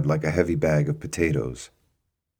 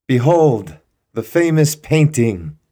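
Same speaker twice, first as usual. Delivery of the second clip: raised, louder showman's announcement; grand proclamation; 8 semitones higher, 7 dB louder than usual. Acted. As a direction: present it like the unveiling of the century. Bernard proclaims to the room.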